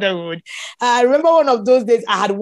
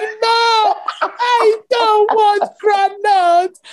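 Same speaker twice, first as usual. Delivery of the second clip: much higher, very slow whining refusal; whiny demand; 10 semitones higher, much slower than usual. Retold or imitated. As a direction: drag it out like a petulant pregnant craving. Pregnant wife refusing husband's offer.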